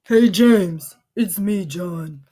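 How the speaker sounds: put-on boy putting on a tough guy voice for his video; playful mock tough guy greeting; kid's mock deep voice. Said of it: "Owen says in a super deep voice".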